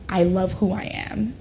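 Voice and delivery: ordinary talk, nothing marked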